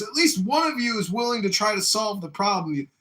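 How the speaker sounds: high, loud acted declaration; emphatic sergeant praise. Drill sergeant praises recruit's effort, loud and emphatic. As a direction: proclaim it like an impressed sergeant.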